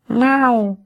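high mewing meow; cat meow. A narrator's reading. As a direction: mew like a kitten. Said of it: "кто-то сказал". (кошачье мяуканье)

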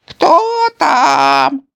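put-on very high, loud old lady's question; a grandmother calling through the door; old granny voice. A narrator's reading. (бабушка спрашивает из-за двери, высоким старческим голосом)

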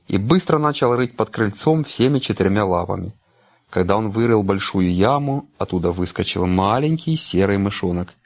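plain reading voice

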